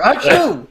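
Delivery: sneeze. Acted. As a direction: sneeze hard. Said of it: "It's so dusty in here".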